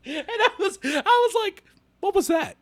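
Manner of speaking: high-pitched, laughing incredulity; laughing bafflement. Real, not acted. Fan recalling the wax-like head bite, baffled and laughing. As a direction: squeeze the words out through laughter.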